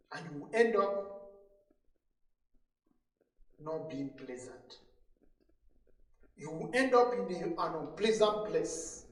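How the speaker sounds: usual speaking voice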